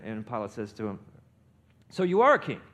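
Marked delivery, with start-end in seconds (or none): 1.9-2.6 loud, raised-pitch challenge; acted-out Pilate's challenge, louder and higher; incredulous questioning. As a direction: throw it out as a pointed question. Acting Pilate questioning Jesus.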